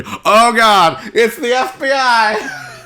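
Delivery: high, loud, slow FBI panic; mock panic. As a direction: shriek it in mock alarm.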